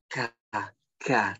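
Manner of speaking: ka with breath escaping; breathy kaf. Shown as a demonstration. Teacher demonstrating breathy kaf. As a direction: let air out on ka. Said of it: "nafas yang keluar dari mulut".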